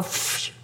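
soft unvoiced phew of relief; relieved exhale. Escaping the gas station, a soft relieved phew. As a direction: blow out a breath of relief.

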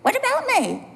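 high, whiny complaint to God; jealous teacher whining to God; mock self-pity. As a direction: pout at the Lord.

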